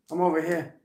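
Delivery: "over here" in Rhode Island accent, r's dropped. Shown as a demonstration. Showing off the flourishing accent, "over here" in Rhode Island accent. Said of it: "Why is my Rhode Island accent".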